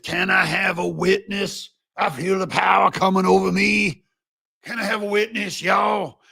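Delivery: high, hammed-up revival preacher; mock televangelist. Host imagines pundit with handkerchief, mock televangelist. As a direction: call to the congregation for a witness.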